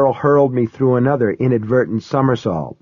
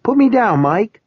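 put-on very high child's voice; high, excited kid voice; excited little girl; 11 semitones higher than usual. A narrator's reading. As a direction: pipe up as an eager small child. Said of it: "Kyra sounded excited".

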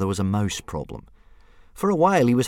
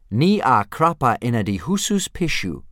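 louder, higher dragon-tongue phrase; spoken Dragonese; 5 semitones higher, 7 dB louder than usual. Acted. Book reader demonstrating a Dragonese phrase, louder and higher. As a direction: pop out the strange dragon words. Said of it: "punctuated by shrill shrieks and popping noises".

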